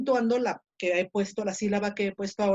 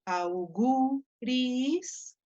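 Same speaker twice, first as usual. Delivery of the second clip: "auguris" very slow, rising at the end; stressed syllable drawn out; 3 semitones higher, much slower than usual. Shown as a demonstration. Teacher points students to the word, "auguris" slowly stretched on GU. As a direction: draw out GU in "auguris" and lift the ending. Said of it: "fíjense aquí".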